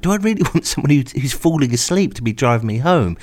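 ordinary delivery

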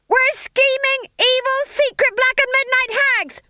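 very high, loud chant; screamed chorus. A narrator's reading. Witches at climax of the drill, screaming back. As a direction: shriek it all together.

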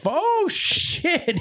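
high, startled yelp. Retold or imitated. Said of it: "the damn thing's like".

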